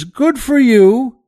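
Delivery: high, loud and slow, beaming approval; a big pleased congratulation; hearty praise. Acted. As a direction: draw out the praise like a proud headmaster. Director praises the right answer.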